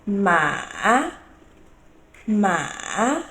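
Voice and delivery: "ma" dipping low, then swinging up; dipping Mandarin third tone on "ma"; deep tone. Shown as a demonstration. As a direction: draw out "ma", sink down and rise back up. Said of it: "A deep tone".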